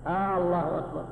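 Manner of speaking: آه، الله أكبر with long "aa" before the doubled l; drawn-out questioning hamza. Shown as a demonstration. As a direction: stretch the first A of الله. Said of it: "كأنك تستفهم".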